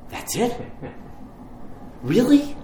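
disbelieving, with a rising squeak on really; as if let down by a small ask; a crowd going that's it, really; incredulous crowd. Retold or imitated. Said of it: "imagine everybody going".

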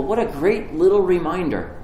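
usual speaking voice

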